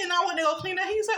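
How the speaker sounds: normal talking voice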